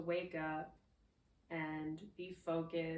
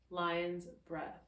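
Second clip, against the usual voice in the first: usual speaking voice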